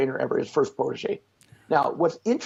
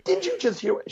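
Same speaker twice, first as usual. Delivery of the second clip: mock incredulous. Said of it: "I'm like".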